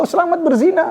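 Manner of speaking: sing-song greeting, pitched well up with a rising end; chirpy fake well-wishing; mock cheerful congratulation. Preacher mocking a cheery greeting to a sinner, bright and high.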